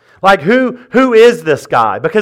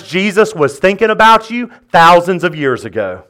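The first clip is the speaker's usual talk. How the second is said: ordinary talk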